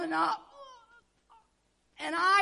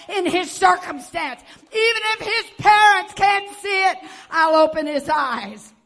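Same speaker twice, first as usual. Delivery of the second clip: God's voice; 10 dB louder than usual. Acted. God's promise voiced, rising loud.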